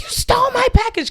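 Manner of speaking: high mock Karen accusing. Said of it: "she's like".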